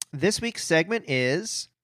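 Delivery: usual speaking voice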